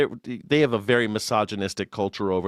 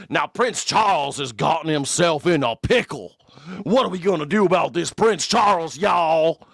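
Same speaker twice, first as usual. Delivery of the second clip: put-on Southern American accent, pitched up and twangy; mock American accent; 5 semitones higher than usual. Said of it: "an American accent talking about".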